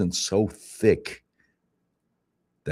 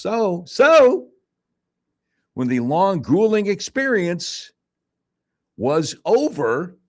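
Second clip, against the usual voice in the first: exasperated emphasis; 11 semitones higher, 3 dB louder, slower than usual. Real, not acted. Dramatic build-up of ordeal.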